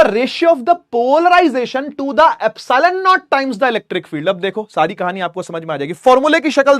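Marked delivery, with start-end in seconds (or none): 0.0-4.3 slow dictation. Shown as a demonstration. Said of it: "reading definition".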